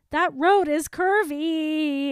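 mock yell. Cohost reprising her original yell about the road, slow mock yell. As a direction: drag it out like a half-joking warning. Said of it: "I still hold with my original yell".

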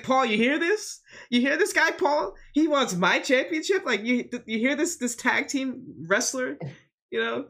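high-pitched, incredulous mockery; Roman Reigns. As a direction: scoff at a challenger through a hammed-up high voice. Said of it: "He's laughing".

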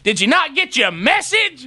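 high, slow, incredulous challenge; mock him with a stretched, high-pitched question; sarcastic confrontation. Confronting late installer sarcastically.

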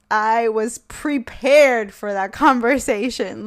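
"I was prepared" drawn out slowly, each word weighed; proud emphasis. Real, not acted. Telling her audience, slow proud boast. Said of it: "slow, proud repeat".

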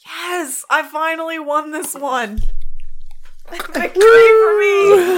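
high, loud gloating win; triumphant. Real, not acted. Wins drinking race.

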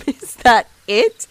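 laughing tease. Acted. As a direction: tease him while still laughing. Laughing at his uniform.

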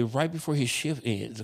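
ordinary delivery

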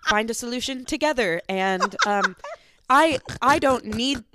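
high, loud, laughing through a hammed-up ask; laughing mock request. To her friend, retelling her plea, laughing high. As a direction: play your own fumbling plea for laughs.

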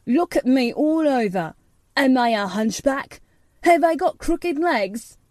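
put-on boy challenging the gardener, imperious; commanding. A narrator's reading. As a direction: demand an answer, proud and loud. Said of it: "he commanded".

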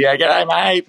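high-pitched imitation Aussie greeting; booming Aussie. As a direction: put on a big Aussie call. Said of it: "the big booming sort of Aussie".

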